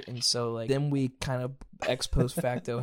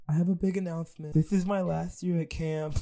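imitating the camper's announcement; 5 semitones higher, 4 dB louder than usual. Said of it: "he'd be like".

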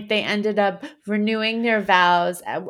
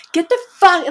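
retold angry yell; 10 semitones higher, 7 dB louder than usual. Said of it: "and I was like".

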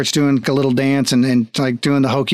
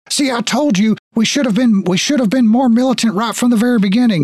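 high-pitched conspiratorial griping; agitated guard; 9 semitones higher than usual. Acted. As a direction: grumble to a comrade in a high, worked-up voice. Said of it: "you hear one of them go".

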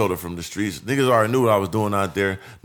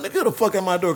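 retold indignant challenge; 8 semitones higher than usual. Acting out answering the door.

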